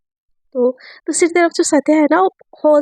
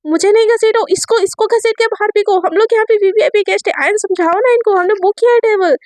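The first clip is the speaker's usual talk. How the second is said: raised, furious higher voice; angry outburst; 7 semitones higher than usual. Acted. Aadhya shouting at security, furious and high. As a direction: yell at the guards dragging you out. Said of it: "आध्या का ना दिमाग खराब हो जाता है".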